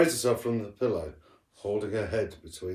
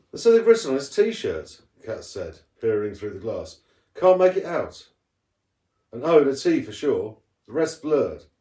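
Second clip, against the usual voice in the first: excited; 3 semitones higher, 7 dB louder than usual. A narrator's reading. Kat excited over the clue.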